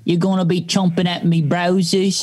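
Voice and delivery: put-on English accent. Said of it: "we're doing it in an English accent this time".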